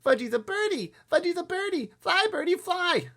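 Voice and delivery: put-on very high, loud toddler crowing; excited small boy. A narrator's reading. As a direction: shout gleefully like a tiny kid playing bird. Small boy pretends to fly.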